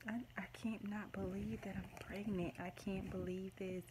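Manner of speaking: whispered in disbelief; awed whisper. Real, not acted. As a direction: whisper it, too stunned for full voice. Overwhelmed by positive pregnancy test.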